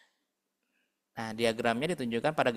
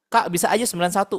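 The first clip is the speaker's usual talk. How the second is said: lightly voiced pupil, higher and louder; quick, raised mock-student interjection; imitated student objection; 6 semitones higher, 6 dB louder, faster than usual. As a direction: pipe up fast like a pupil objecting.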